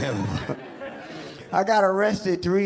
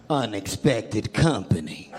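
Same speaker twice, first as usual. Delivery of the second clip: low, slow bum voice; slow, lightly voiced bum; 11 semitones lower than usual. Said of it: "This bum is like".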